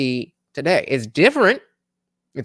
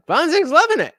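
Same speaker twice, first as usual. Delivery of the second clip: Bonzig's loving it said much higher, with a gleeful lift on loving; excited squeal; 12 semitones higher, 5 dB louder than usual. Real, not acted. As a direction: gush it up high like delighted teasing. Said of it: "But, and Bonzig's loving it".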